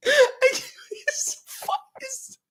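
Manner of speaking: very high, tearful laughing voice; can barely speak for laughing; crying with laughter. Real, not acted. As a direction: squeak the words out through real tears of laughter. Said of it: "I'm fucking crying, bro".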